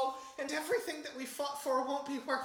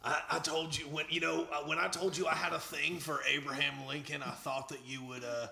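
deep sultry voice; 12 semitones lower than usual. Acted. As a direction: purr it low. Deep sultry Lincoln fan.

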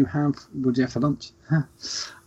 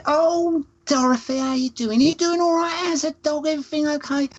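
put-on much higher, sing-song sweet old lady greeting; imitating Nan Peggy. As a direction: gush over her friend like a doting nan. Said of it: "And she said".

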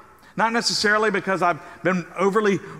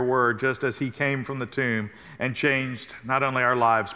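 prayer; 5 semitones lower than usual. Acted. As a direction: lead the congregation in prayer. Leads the congregation in prayer.

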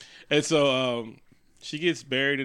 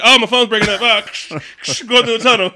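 mock excuse, higher and louder; 8 semitones higher, 11 dB louder than usual. Voicing man dodging call.

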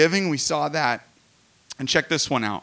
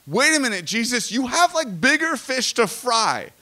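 higher-pitched, incredulous protest; incredulous objection; 6 semitones higher than usual. Acted. Preacher challenging Jesus on the cross, incredulous protest. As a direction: object as if you can't believe his priorities.

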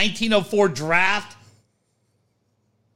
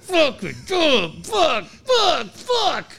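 higher, shouted curses building; escalating higher shouts; frustrated punching; 9 semitones higher, much slower than usual. Pretend. [name] punching bag, frustrated.

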